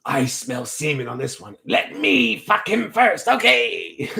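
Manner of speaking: slow succubus voice rising high; hammed-up high demon squeal. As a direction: play a greedy she-demon, drawing it out.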